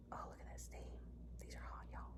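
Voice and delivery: hushed whisper over the steam. Real, not acted. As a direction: whisper in awe.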